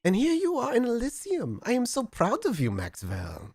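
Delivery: put-on high, gushing German accent; Gustav. Sire praises Max, proud high German accent. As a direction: lay on the pride in a hammy German accent. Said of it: "in a horrible German accent".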